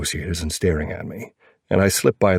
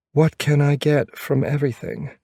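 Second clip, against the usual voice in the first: female character voice; 9 semitones higher than usual. A narrator's reading. Narrator voicing girlfriend's question.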